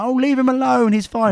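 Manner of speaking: high mock woman's voice; hammed-up high nurse voice; mimicking the nurse. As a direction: pitch it up like a meddling nurse.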